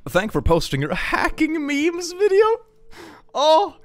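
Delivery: high-pitched silly read; high squeaky voice. Pretend.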